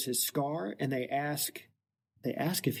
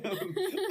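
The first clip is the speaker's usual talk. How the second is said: laugh. Real, not acted. Laughing mid-quote.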